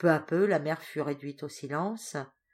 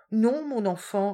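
slow, high, louder gentle no; gentle refusal; 6 semitones higher, 7 dB louder, slower than usual. Acted. Doña Rosario gently refusing Teresa, slow. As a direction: refuse kindly and slowly.

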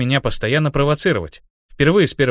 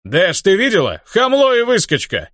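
loud, higher indignant shout; 7 semitones higher, 4 dB louder than usual. A narrator's reading. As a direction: shout it across the room, outraged. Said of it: "крикнул своему лидеру". (возмущённый крик)

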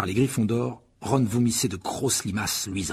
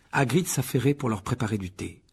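ordinary delivery

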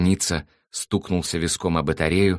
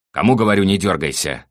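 sudden loud, high-pitched command; angry shouted order; 5 semitones higher, 9 dB louder than usual. A narrator's reading. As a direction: yell at a struggling captive to obey. Thug repeats order to captive.

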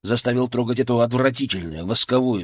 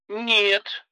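cut off mid word; 11 semitones higher than usual. Acted. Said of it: "Она осеклась на полуслове".

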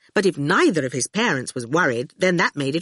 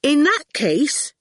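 put-on boy's voice; 9 semitones higher, slower than usual. A narrator's reading. Howard replies.

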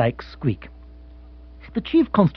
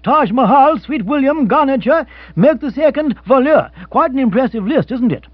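higher, lively racing announcer; radio race commentator; 10 semitones higher than usual. Acted. The radio reads the derby runners to the room. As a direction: call the runners like a broadcaster.

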